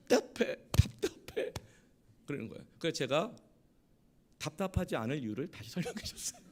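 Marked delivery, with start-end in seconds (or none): 0.1-1.5 high, slow mock whine; imitated stifled wife. Wife suddenly complains to her husband, imitated high whine. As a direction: put on a wife's strained, drawn-out complaint. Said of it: "그때 갑자기 아내가".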